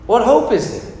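hammed-up anxious questioner; desperate Nicodemus. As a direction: plead in a high worried voice. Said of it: "Imagine Nicodemus is like".

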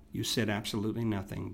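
ordinary delivery

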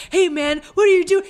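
high, loud imitated outburst; mock aggressive confrontation. Imitating him jumping on the cyclist, mock aggression. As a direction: act out a hothead jumping someone. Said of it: "he jumps on this guy".

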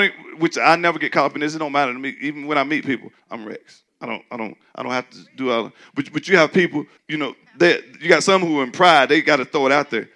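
normal talking voice

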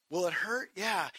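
usual speaking voice

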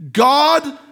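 usual speaking voice

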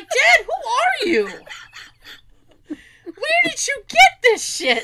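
high, slow, laughing disbelief; dad, who are you, said high through laughter; incredulous disbelief. Retold or imitated. Shocked at dad's rude reply.

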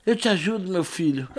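a hint of a gentle elder, quietly; lightly voiced old man. Old patient offering help to injured stranger, softly.

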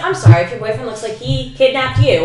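loud, high sassy comeback; sassy snark. Retold or imitated. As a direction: snap it out loud and high with sass. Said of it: "I'm just like".

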